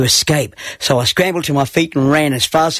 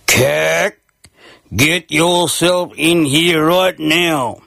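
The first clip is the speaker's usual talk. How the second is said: annoyed father's slow, raised call; stern dad calling out slowly, a bit higher; strong, slow bellow; 4 semitones higher, much slower than usual. A narrator's reading. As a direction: summon a naughty son, drawing out each word. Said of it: "with his strong, slow bellow".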